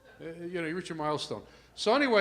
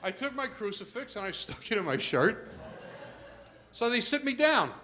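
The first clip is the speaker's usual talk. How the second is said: ordinary talk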